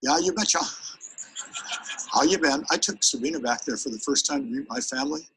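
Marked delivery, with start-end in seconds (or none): 0.0-2.7 hearty Minnesotan greeting; higher-pitched Minnesota lilt; Minnesota accent. Acted. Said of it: "my family is from Minnesota".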